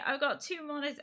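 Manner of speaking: high-pitched proud boast; showing off. Retold or imitated. Streamer showing two monitors to the kids, showing off.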